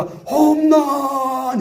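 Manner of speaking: long drawn-out high call of a home run; caster's home run call. Retold or imitated. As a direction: stretch it out like a baseball announcer. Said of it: "캐스터들 목소리가 막 높아집니다". (야구 캐스터처럼 길게 뽑는 "홈런!" 외침)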